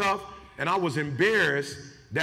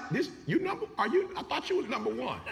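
surprised visitors; 6 semitones higher, 5 dB quieter than usual. Retold or imitated. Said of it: "they be like".

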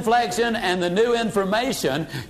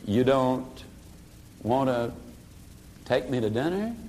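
calm echo; 7 semitones lower, 7 dB quieter than usual. Shown as a demonstration. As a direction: turn it back gently with a low rising question. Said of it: "You simply look at him and say".

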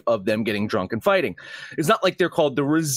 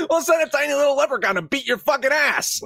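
mock threat; 14 semitones higher than usual.

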